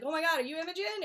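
high, fast, astonished; surprised. Retold or imitated. Posthumus suddenly recognising his wife, astonished. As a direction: blurt it out in shock.

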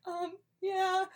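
high, sheepish um yeah; awkward admission. Retold or imitated. Murderers caught out by Clarence, sheepish high um yeah. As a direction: admit it awkwardly. Murderers admit their purpose.

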